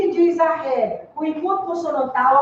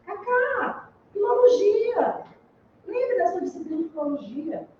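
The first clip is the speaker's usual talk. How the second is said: imitated professor; 5 semitones higher than usual. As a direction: imitate a teacher calling a pupil, pitched high. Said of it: "Aí ele dizia assim". (imitando o professor, com voz aguda)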